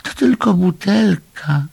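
high little-animal voice, let down; disappointed. A narrator's reading. Little animal finding only a bottle, high disappointed voice. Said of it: "zmartwił się rozczarowany zwierzaczek".

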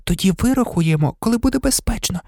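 high, softened planning voice; still shaken. A narrator's reading. Hermione planning the flight to Sirius, still shaken. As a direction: lay out the plan in a thin, high hush. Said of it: "не тямилася від пережитого".

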